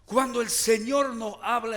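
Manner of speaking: usual speaking voice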